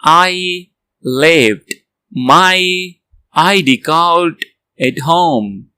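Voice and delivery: drawn-out careful sentence; very slow model reading. Shown as a demonstration. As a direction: stretch each word for learners. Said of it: "very slow read".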